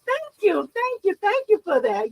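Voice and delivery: bright high-pitched thank-yous; gushing thank you; high cheery thanks. Real, not acted. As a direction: sing out the thanks to a viewer.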